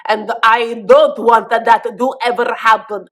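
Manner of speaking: badly faked Egyptian accent, stern and deliberate. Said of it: "I actually cannot do an Egyptian accent".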